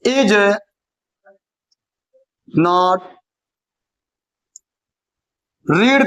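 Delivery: a hint of dictation, spaced words; slow dictation. Teacher dictating the next sentence, lightly spaced.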